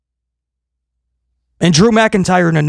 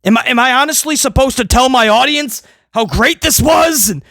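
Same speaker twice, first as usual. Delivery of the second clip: ordinary talk, nothing marked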